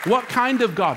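emotional. Real, not acted. Preacher overwhelmed by God's sacrifice, emotional. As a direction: ask it in real, overwhelmed amazement. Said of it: "get over my emotion".

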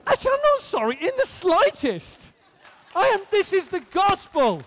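high-pitched, fervent preaching cry; impassioned, exultant declaration; unapologetic excitement. Real, not acted. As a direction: burst out, refusing to tone it down. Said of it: "I'm sorry I'm excited".

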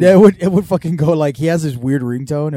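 ordinary talk

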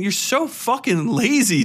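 normal talking voice

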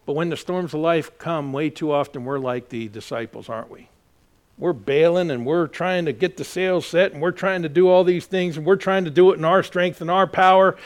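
ordinary talk, nothing marked

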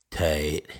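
low, drawn-out quoted movie line; McLovin. Retold or imitated. Quoting a Superbad gun scene, imitated McLovin. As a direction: quote the film character in a low voice. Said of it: "where he shoots a gun and he goes".

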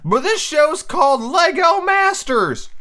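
high, slow, shouted title reveal; big announcement. Real, not acted. Host recommends a new show, booming drawn-out announcement.

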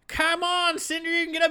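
half-hearted cheer from the cliff top; coaxing officer. Acted. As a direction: call down to him with fake concern. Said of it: "looking down like".

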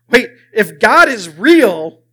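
loud and slow, weighing each word; alarmed realization. Retold or imitated. Prisoner realizing what God's existence means, loud and drawn out. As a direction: stretch it out forcefully as it sinks in. Realization hits him, acted out.

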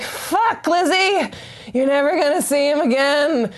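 high, hammed-up lament of regret; mock self-scolding. She berates herself back home, high mock despair. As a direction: wail at yourself in mock despair.

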